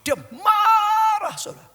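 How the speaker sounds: shouted high and loud, drawn out slowly; fiery preaching. Acted. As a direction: thunder it from the pulpit. Preacher shouts the family's anger. (berkhotbah sambil berteriak)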